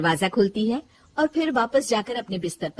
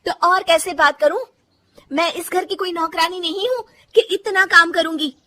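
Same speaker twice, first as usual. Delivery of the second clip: raised, loud defiant talking back; angry retort; 8 semitones higher, 6 dB louder than usual. Acted. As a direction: snap back at the mother-in-law, high and loud. Bahu quarrels with mother-in-law.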